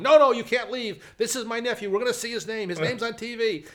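high, booming proud-uncle protest; loud insistent pleading. Retold or imitated. Uncle stopping residents from leaving, loud insistence. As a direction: call out to stop people walking away.

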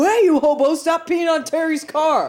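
calling out. Pretend. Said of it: "you could run out and go".

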